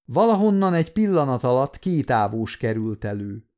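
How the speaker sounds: ordinary delivery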